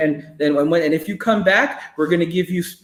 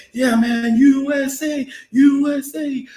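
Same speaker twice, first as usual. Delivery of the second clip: higher, hammed-up chant; mock patriotic cheer; 7 semitones higher, slower than usual. Mocking cheer for guys going to war. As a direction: cheer them off sarcastically.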